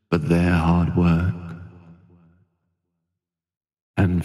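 slow, soothing hypnotherapy voice with long pauses; guided sleep meditation. Acted. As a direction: ease the listener gently towards sleep, unhurried.

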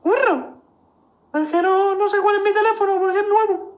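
high squeaky falsetto, fast; like Mickey Mouse; man doing a woman's voice. Pretend. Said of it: "parece Mickey Mouse".

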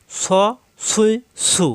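স, সুই, সু thick and rounded; three separate full-mouthed syllables স, সুই, সু; heavy s. Shown as a demonstration. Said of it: "অর্থাৎ মোটা".

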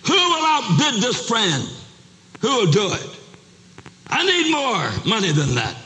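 high, forceful auctioneer's challenge; wicked auctioneer. Acted. Wicked auctioneer challenging the crowd to outbid, forceful call. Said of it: "That wicked auctioneer glared at him".

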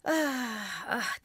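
sighing. A narrator's reading. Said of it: "bir göğüs geçirerek".

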